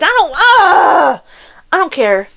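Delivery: words breaking into a high "ah"; frustrated yell. Real, not acted. Ranting about book heroines, frustrated yell. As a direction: give up mid-sentence and yell in exasperation.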